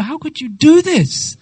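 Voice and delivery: mock-indignant protest; acted upset. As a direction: voice a storyteller's upset accusation. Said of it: "she becomes so upset with Lalita".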